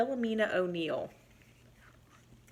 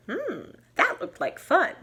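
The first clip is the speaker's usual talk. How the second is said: mouse voice; 9 semitones higher than usual. Acted.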